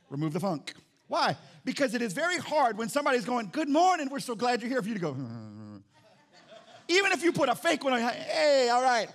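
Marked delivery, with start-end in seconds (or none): 3.5-4.8 high, loud, bright-eyed church greeter welcome; cheerful greeter. Retold or imitated. 8.0-9.1 forced, loud mock-cheerful reply; fake cheer. Fake-smile reply to greeter.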